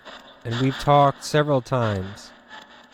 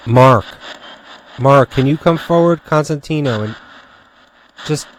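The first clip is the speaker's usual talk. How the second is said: loud call into the dark; calling out to a ghost; 8 dB louder than usual. Real, not acted. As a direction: call out loudly to the spirit. Calling out to a spirit.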